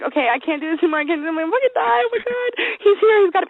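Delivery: high, squeaky mock panic; retold freak-out, pitch climbing; panicking. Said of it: "I start having a panic attack, and I'm freaking out".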